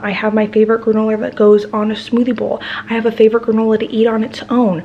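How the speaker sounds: ordinary talk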